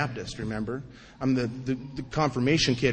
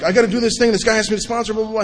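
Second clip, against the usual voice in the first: excited rush; 7 semitones higher, 4 dB louder, much faster than usual. Retold or imitated. Rushing to tell his sponsor.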